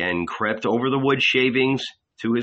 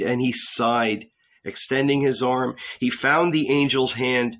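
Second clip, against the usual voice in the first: plain reading voice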